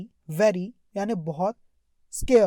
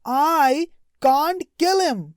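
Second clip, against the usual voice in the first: tailor's fear; 6 semitones higher, 6 dB louder, much slower than usual. Retold or imitated. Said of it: "tailor's fearful thought".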